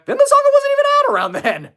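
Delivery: loud, high-pitched protest; high, loud and incredulous; incredulous outburst. Real, not acted. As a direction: blurt it out in disbelief, voice shooting way up. Indignant reply to a chat question.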